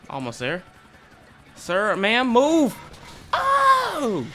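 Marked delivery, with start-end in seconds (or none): 1.6-2.7 loud, rising yell to clear the way; urgent shout. Real, not acted. Pilot yelling at pedestrians while landing, urgent shout. 3.3-4.2 very high, loud "oh" scream; startled scream. Real, not acted. Gamer reacting to a rough landing, startled scream.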